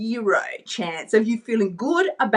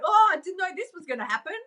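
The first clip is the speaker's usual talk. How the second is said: mock surprised victim; 7 semitones higher, faster than usual. Mock clueless dieter.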